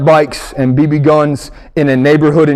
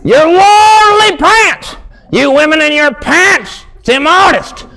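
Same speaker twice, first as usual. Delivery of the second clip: preacher voice; 11 semitones higher, slower than usual. Retold or imitated. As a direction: rail at the women from the pulpit. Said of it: "preacher voice".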